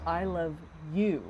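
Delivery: "I love", a short pause, then "you" set apart; stress on you. Shown as a demonstration. Somebody treating the other as a subject, stress on "you". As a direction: separate "you" from "I love" with a beat. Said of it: "treating that other person as a subject".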